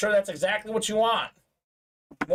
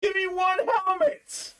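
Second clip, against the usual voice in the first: high, whiny, fake-tearful pleading; mock crying plea; 10 semitones higher than usual. Host voicing a buyer's crying emojis, mock sobbing plea. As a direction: beg like a crying kid. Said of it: "He's like".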